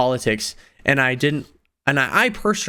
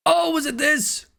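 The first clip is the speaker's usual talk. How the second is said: surprised. Real, not acted. Finding the old deck online.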